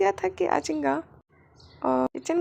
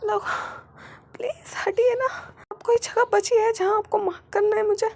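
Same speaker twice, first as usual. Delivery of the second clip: high, tearful louder pleading; whimpering plea; 7 semitones higher, 4 dB louder than usual. Acted. Wing begging his husband to move away, tearful. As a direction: beg with a sob in the voice.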